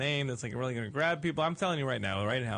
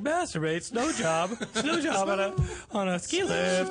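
put-on higher, slow kid voice with a wobble; imitating prepubescent voice; 8 semitones higher, 4 dB louder, slower than usual. Mock kid voice teasing his co-star. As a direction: play the awkward boy who can't say it. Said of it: "You were like".

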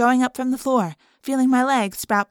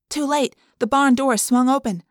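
usual speaking voice

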